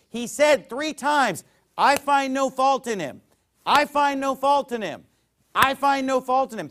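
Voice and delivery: high, loud, deliberate repetition; emphatic preaching. Acted. Preacher quoting Pilate to congregation, pounding it out. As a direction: hammer each "I find no fault in him".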